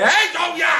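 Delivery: loud, high mocking hey; jeering greeting; taunting call. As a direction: call out to needle him.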